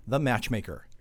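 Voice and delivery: ordinary talk, nothing marked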